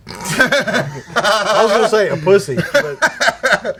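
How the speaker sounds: talking through laughter; laughing. Real, not acted. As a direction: laugh your way slowly through the jab. Laughing while finishing the joke.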